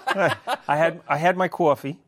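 put-on playful take-off of a New York accent; imitated New York accent. Guest teasing the host about his speech, imitated New York accent. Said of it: "imitating me now".

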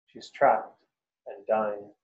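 ordinary delivery, nothing marked

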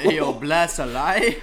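loud, slow Toronto roadman slang; Toronto slang accent. Pretend. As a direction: stretch it out like Toronto street talk. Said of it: "People talk like that here".

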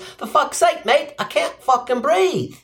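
high-pitched Australian cry, slowing down; Aussie mock shout. Aussie man pleading with cops, high mock Aussie cry. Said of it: "high probability of also shouting the phrase".

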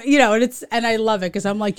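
normal talking voice

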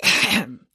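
polite "ahem"; elderly man's ahem; throat clearing. Acted. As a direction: clear your throat to interrupt. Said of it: "said Professor Abernathy".

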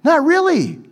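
high, quick joking take-back; joking retraction. Real, not acted. Pastor undercuts his own boast, playfully. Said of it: "That's why you're listening to me".

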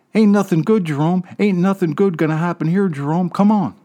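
put-on high, urgent little boy begging; high pleading kid voice. A narrator's reading. Little brother begging Jerome to leave, high and urgent. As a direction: pull your brother away, high and anxious.

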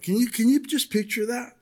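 ordinary delivery

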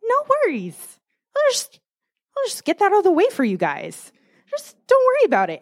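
high, breezy voice of God; easygoing, high-pitched reassurance from God; casual God. Retold or imitated. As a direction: play God lightly brushing off a problem. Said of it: "And God's like".

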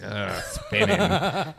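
drawn-out, disgusted groan on the word; mock disgust. Scoffing at a Dairy Queen worker's excuse, slow disgusted groan. Mocking the hot dog excuse.

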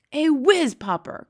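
loud, high, drawn-out cry of delight. A narrator's reading. Giant beaming at Sophie, delighted cry. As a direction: beam as you call it out. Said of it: "cried the B F G, beaming at her".

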